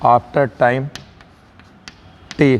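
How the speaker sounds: long pause before the final letter; spaced out for note-takers; word-by-word dictation. Shown as a demonstration. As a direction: dictate in small separate pieces.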